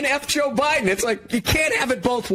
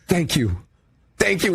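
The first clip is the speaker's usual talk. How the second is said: lower, mock-gracious thank you; Trump; 5 semitones lower than usual. A politician at the fights, mock-gracious lower thanks. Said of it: "when Trump was going".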